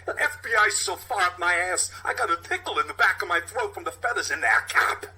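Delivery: captain ranting about the FBI; still angry. Acted. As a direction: keep the anger simmering. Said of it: "goes from pleasant to terrifying".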